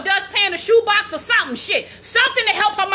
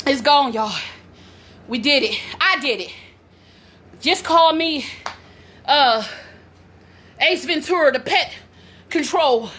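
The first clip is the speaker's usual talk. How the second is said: ordinary delivery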